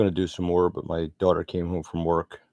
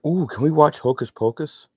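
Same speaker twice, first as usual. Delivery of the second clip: high, louder, excited daughter impression; imitating eager daughter; 10 semitones higher, 6 dB louder than usual. Daughter asks dad for a movie night, imitated excitedly. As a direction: pitch it up like a daughter eagerly asking.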